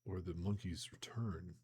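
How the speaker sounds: plain reading voice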